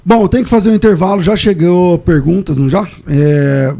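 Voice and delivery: host announcing the break, loud and high; loud host call. Real, not acted.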